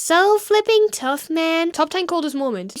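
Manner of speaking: high, drawn-out mocking praise; mock awe. Reacting to a stranger's brag post, mock awe. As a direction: stretch it slowly, hammed-up admiration.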